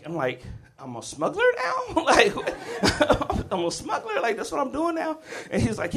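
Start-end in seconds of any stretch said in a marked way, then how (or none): 0.8-5.2 high, laughing, incredulous question; laughing disbelief. Retold or imitated. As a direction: laugh through the outrage. Kid baffled by dad's plan.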